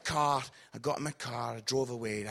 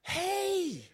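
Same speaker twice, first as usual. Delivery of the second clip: fake recognition; 10 semitones higher, 4 dB quieter than usual. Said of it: "I went".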